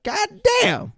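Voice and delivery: very high mock-amazed exclamation; retold astonishment. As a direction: imitate a movie tough guy acting amazed, voice leaping up. Retelling Jackson's astonished line.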